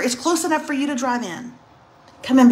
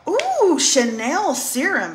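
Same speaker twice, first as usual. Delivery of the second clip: high drawn-out ooh, then slow admiration; acted-out gift reaction; retold delighted ooh; 5 semitones higher, much slower than usual. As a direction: coo over a fancy gift.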